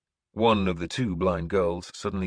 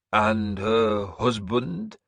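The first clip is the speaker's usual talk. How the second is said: put-on old seeress announcing; 3 semitones higher, much slower than usual. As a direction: proclaim like an eerie prophetess. Breda asks.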